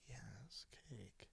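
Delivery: soft whispered yes cake; quiet eager whisper; hushed. Retold or imitated. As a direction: whisper it greedily. Friend offers cake.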